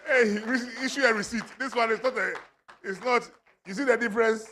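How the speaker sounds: high, loud, laughing through words; laughing glee at the gift; laughing delight. Real, not acted. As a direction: giggle it out, thrilled.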